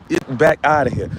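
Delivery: ordinary delivery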